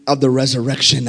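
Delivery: hushed preaching. Real, not acted. Preacher quietly explaining the proof of resurrection, hushed and low. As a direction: pull right back and draw the listeners in. Sudden drop after climax.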